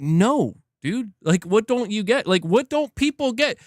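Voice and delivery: mock fed-up what don't you get; annoyed. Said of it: "You could tell [name] was getting annoyed".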